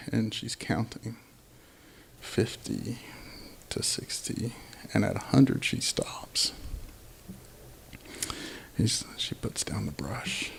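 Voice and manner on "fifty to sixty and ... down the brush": soft, breathy whisper as if peeking in; hushed whisper. A narrator's reading. Storyteller peering through the window at Katrina, whispering. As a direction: keep it secret, barely voiced.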